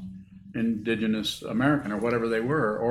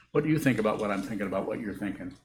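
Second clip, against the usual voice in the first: rattled off quickly in one breath; fast, unbroken run of tangled words; quick run-through; faster than usual. Shown as a demonstration. Teacher mimicking circular thoughts for students, quick run-through. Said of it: "So I'll say it very slowly".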